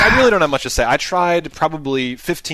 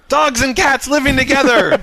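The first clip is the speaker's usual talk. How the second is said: mock doom; 10 semitones higher, 4 dB louder than usual. Mock apocalyptic movie line quoted.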